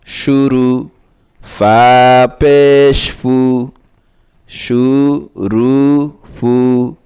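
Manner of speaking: measured, chanting recital of spelled syllables; recitation drill. Shown as a demonstration. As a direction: read each syllable out slowly like a teacher drilling a class. (ধীরে ধীরে সুর করে বানান পড়া)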